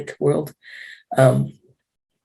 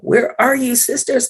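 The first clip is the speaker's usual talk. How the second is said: calling out; 10 semitones higher than usual. Retold or imitated. Calling out to missing women.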